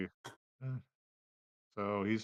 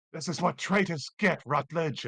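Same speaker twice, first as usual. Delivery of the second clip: raised, higher-pitched in-character threat; acted righteous anger, pitched up; vengeful battle taunt; 6 semitones higher, 4 dB louder than usual. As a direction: call it out as you strike at a traitor. Game master voices attacker striking Rutledge.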